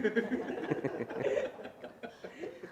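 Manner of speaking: soft breathy laugh; a hushed giggle at the punchline; quiet laugh. Real, not acted. Laughing at his own joke.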